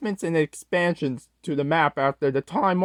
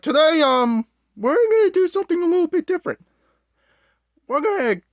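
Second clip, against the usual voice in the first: much higher, fast put-on host voice; 12 semitones higher, faster than usual. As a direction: rattle it off in a squeaky presenter voice. Put-on high intro voice.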